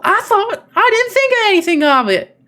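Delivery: high, loud Southern belle voice; high-pitched Southern belle act. Acted. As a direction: put on a hammed-up Southern lady. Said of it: "Southern Belle".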